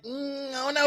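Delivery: high, quiet doubtful mumble; unsure inner voice. Retold or imitated. Friend mimics a doubtful fan, high and hushed. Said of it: "but you were like".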